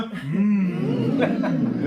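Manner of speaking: long appreciative "mm"; murmur of delight. Shown as a demonstration. Host leads audience after a poem, delighted murmur. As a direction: hum approval, drawn out. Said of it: "Do we murmur?".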